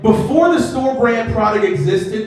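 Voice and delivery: ordinary delivery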